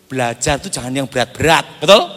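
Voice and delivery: normal talking voice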